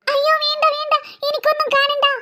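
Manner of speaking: high, scared refusal; frightened pleading. Acted. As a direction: beg off in acted fear. Girl begs ghost not to show.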